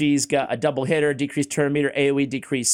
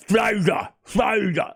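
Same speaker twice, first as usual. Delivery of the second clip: higher, shouted caveman grunt; 6 semitones higher, slower than usual. Acted. Host mocking his own pronunciation, shouted like a caveman. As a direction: blurt the name out like a caveman. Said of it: "I'm a, such a caveman".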